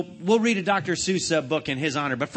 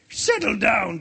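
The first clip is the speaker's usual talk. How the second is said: shouted; 4 semitones higher, 4 dB louder, slower than usual. A narrator's reading. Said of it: "shouted Mister Krabs".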